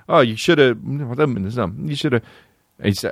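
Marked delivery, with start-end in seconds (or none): 0.1-1.2 mocking his words. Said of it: "what he said, which was".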